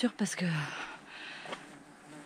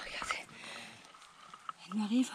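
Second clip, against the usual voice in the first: whispering; 15 dB quieter than usual. Real, not acted. Whispered while watching dog climb.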